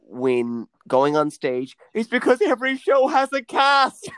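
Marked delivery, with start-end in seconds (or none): 1.9-4.1 loud, high punchline shout. Real, not acted. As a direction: belt out the joke's punchline. Punchline of a pun joke.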